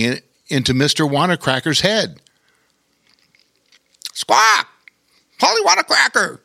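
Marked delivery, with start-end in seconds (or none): 4.1-6.4 very high, quick parrot voice; squawking parrot. A narrator's reading. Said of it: "said the parrot".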